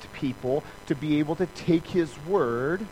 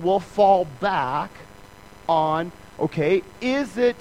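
ordinary talk

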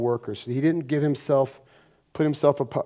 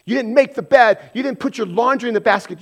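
loud, high nagging rant; mock spouse yelling; 8 semitones higher, 7 dB louder than usual. Spouse yelling about chores at husband, a mock loud nagging rant. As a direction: rattle off the complaints fast. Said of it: "your spouse is yelling at you".

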